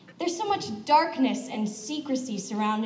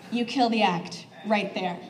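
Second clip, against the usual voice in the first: ordinary delivery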